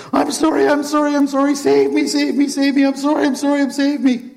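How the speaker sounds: high, loud pleading wail; desperate begging. Acted. Haman on Esther's couch, pleading in a high wail. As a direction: beg frantically for mercy.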